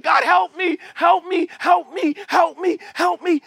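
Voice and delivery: high, insistent cries for help; mock desperate pleading. Sinner begging God for help, high mock desperation. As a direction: plead over and over like a child calling out. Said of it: "like a kid that's crying".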